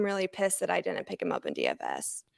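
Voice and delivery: hushed annoyed aside; whispering. Real, not acted. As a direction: whisper the gripe under your breath. Whispered aside about fantasy loss.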